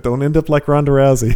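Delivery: normal talking voice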